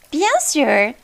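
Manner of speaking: put-on English-accented French; a stiff British fish speaking French. A narrator's reading. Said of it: "toujours dans un accent très anglais".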